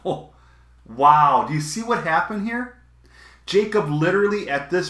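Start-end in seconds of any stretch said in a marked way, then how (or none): none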